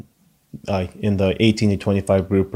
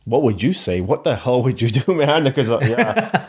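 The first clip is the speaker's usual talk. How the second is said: higher-pitched, breaking into a laugh; real amused laughter in the voice; 4 semitones higher than usual. Real, not acted. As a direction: toss it out through a laugh. Tossing the hard question back, laughing.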